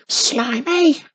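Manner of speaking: high squeaky little witch; tiny witch squeak. A narrator's reading. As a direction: squeak it out as loud as you can. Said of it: "squeaked Wizardy Witch as loud as her tiny voice permitted".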